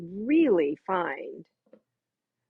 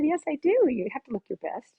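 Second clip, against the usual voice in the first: cheerful reassurance; 6 semitones higher than usual. Retold or imitated. Reassuring guest about makeup.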